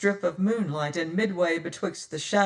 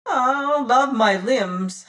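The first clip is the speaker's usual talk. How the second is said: a slow, high, reedy pirate voice, a touch louder; high-pitched voice; 6 semitones higher, 6 dB louder, slower than usual. Acted. Fat boatswain's mate gloating to the captain, high and slow. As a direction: relish each word in a high squeaky drawl. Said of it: "says he in his high-pitched voice".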